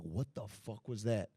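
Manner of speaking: low, slow dazed mutter; stunned under breath. Retold or imitated. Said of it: "I was like".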